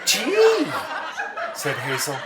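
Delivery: high, laughing voice; giggling Hazel. A narrator's reading. Hazel repeating herself to George, high and giggling. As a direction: giggle it out innocently.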